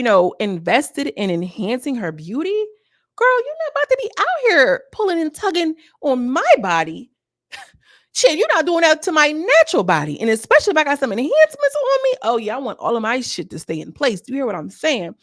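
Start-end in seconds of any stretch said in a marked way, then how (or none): 3.1-12.1 indignant rant. Real, not acted. Ranting about being grabbed.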